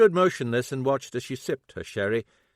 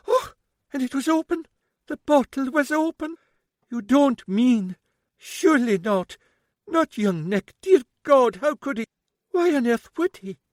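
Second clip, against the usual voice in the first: high, overdone horror, voice soaring; hammed-up shock; 6 dB louder than usual. Fraser putting on false shock for the inspector, overacted. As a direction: fake dismay, far too theatrical. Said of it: "not be nominated for an Oscar".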